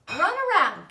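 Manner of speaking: high, loud command. A narrator's reading. Charlotte ordering Wilbur to run, loud and commanding. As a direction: bark the order like a coach. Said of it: "commanded Charlotte".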